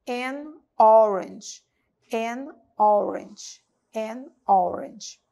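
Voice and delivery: "An orange." very slow, rounded O; slow repeated drill. Shown as a demonstration. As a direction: stretch "An orange." slowly. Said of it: "formato da boca".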